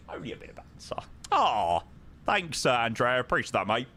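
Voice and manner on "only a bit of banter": whispered aside; a sly whispered repeat. Shown as a demonstration. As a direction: breathe it out in a whisper, no voice. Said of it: "That's only a bit of banter".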